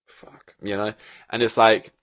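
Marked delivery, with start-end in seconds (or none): 0.1-0.6 breathy whispered swear; whispered curse. Retold or imitated. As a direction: whisper the regret under your breath. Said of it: "was like".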